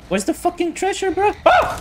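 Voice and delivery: fast, high frantic yelling; being chased. Real, not acted. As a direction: rush it in panic. Searching while under threat.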